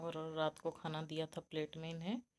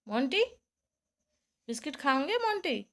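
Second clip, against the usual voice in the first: loud, high offer to a pet; calling the pet; 7 semitones higher, 10 dB louder than usual. Real, not acted. Owner offering Monty a biscuit, high coaxing call. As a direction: coax Monty with a treat.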